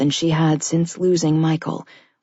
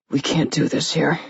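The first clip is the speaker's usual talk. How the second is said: gruff voice. A narrator's reading. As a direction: hold back frustration, gruffly. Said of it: "he said in a gruff voice".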